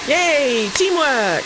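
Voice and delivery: loud, high, drawn-out cheer; triumphant cheer. Real, not acted. Gamer cheering Sims for dousing fire, triumphant cheer. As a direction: celebrate with a big whoop.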